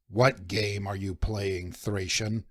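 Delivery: low, slow, soft menacing snarl. Acted. First mate snarling at the stranger on the mast, low and slow. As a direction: growl it down low, slowly. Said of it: "he snarled".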